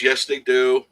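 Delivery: ordinary talk, nothing marked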